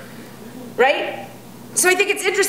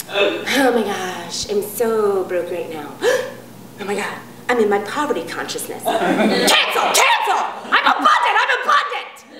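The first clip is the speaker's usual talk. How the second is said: mocking New Thought person; 2 semitones lower than usual. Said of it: "New Thought people will do this".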